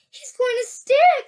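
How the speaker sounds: put-on high-pitched boy voice; young boy. A narrator's reading. Charlie watching the boy in the pipe, high voice. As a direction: say it high and loud like a child.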